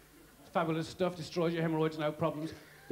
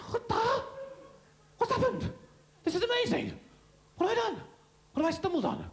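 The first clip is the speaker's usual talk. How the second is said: amazed; 14 semitones higher, 3 dB louder, faster than usual. Acted. Amazed man discovers cure.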